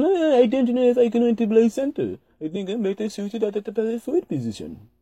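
playful Latvian accent impression. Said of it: "me imitating his Latvian accent".

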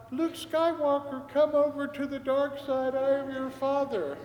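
high kid's voice playing Darth Vader through a mask; a small boy trying to sound like Vader; little boy's Vader. Boy in the mask doing Vader for the priest. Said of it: "in this little eleven-year-old voice".

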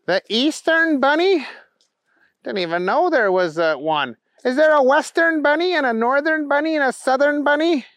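put-on a childlike hero mourning his spilled treasure; Booger's wail. Booger's voice in story.

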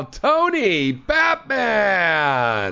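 drawn-out hyped caller intro; excited announcing. Real, not acted. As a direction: stretch the name like a hype man. Host hypes the caller.

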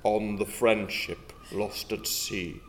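low dramatic narration. Acted. Dramatic scene-setting narration.

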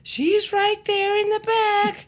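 high, slow squeaky driver; high nervous driver voice. Acted. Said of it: "Do different voices".

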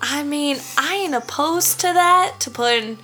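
sugary Southern belle tourist; put-on Southern twang, sweet-talking a stranger; Southern woman accent. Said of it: "My accent is going to be a Southern woman".

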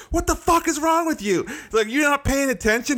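high angry retold outburst; retold yelling. Chewing out the other driver, high angry retelling. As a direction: let him have it, high and heated. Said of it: "I was like".

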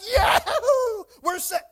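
loud high whooping cheer; mock celebration. Preacher pretending disciples were thrilled, mock whooping. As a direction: whoop it up, hammed-up triumph.

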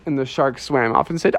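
ordinary delivery

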